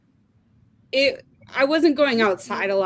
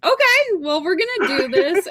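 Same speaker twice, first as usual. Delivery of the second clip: excited; 7 semitones higher than usual. Retold or imitated. Retelling delight at being picked.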